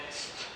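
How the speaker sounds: "st." as a bare s-t cluster; clipped st. Shown as a demonstration. Teacher isolates the sound "st.". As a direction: say "st." with no vowel. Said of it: "le mot c'est devient".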